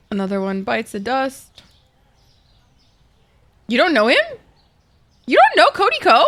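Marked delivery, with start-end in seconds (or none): 3.7-6.2 high, loud disbelieving shout; shocked shout. Real, not acted. Shocked someone doesn't know him.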